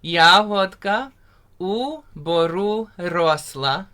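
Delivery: slow, carefully spoken "Yahodka u boru rosla" with rolled r; teacher modelling a Ukrainian line; slow Ukrainian demo. Shown as a demonstration. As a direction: spell out "Yahodka u boru rosla" slowly for learners. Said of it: "Say that one more time".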